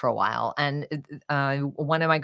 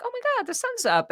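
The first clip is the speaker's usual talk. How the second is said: much higher, bright relieved exclamation; high delighted surprise; faster than usual. Retold or imitated. Telling the host about waking up relieved. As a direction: gasp with joy at the morning.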